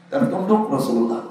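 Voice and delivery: ordinary talk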